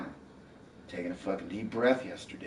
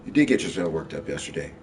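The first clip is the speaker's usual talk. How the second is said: much louder, pressing accusation; loud retort; 9 dB louder than usual. Real, not acted. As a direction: hammer the point home. Heated argument with partner.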